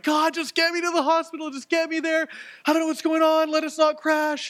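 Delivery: high, pleading, panicked prayer; desperate cry to God. Retold or imitated. Pastor retelling his panicked prayer to God while driving, high and desperate. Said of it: "crying out to God".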